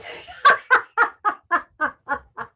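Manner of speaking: loud, high exercise laugh; forced laugh. Shown as a demonstration. Instructor laughing out loud for the class, forced exercise laugh. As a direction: push the laugh out on the breath. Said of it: "laugh out".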